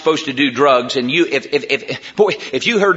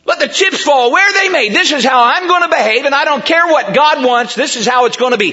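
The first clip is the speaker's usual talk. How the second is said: higher-pitched mock defiance, a hint of stubborn pride; defiant sinner; 8 semitones higher than usual. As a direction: play the sinner who won't budge, lightly. Preacher voices defiant sinner.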